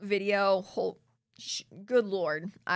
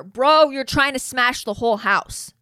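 loud, higher outraged exclamation; loud higher outrage at the screen; 4 semitones higher, 10 dB louder than usual. Real, not acted. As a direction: blast it out in outrage. Outraged reaction to his quote.

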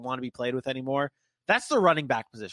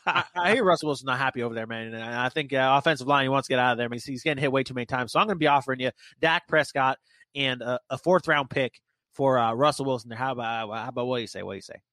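put-on fast, lower, rambling Boomhauer-style Texan accent; Jerry Jones Boomhauer accent; 4 semitones lower than usual. Pitching a trade to a co-host as a rambling Boomhauer-accented owner. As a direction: rattle it off fast, low, in a loose Boomhauer Texas accent. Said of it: "Jerry Jones has a Boomhauer accent from King of the Hill".

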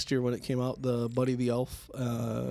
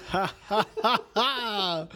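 laughing; 7 semitones higher, 3 dB louder, much slower than usual. Real, not acted. Laughs after the cough.

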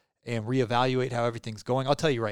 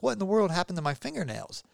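higher, amazed question; mock surprise; 6 semitones higher than usual. Amazed at his own nails, a higher surprised question. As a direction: retell the shock at hard nails. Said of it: "I was like".

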